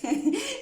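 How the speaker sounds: a sudden high giggle; high burst of laughter. Real, not acted. Laughing at a viewer's comment about many voices, a high giggle.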